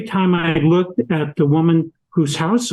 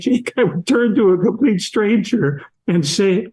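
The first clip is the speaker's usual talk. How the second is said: ordinary talk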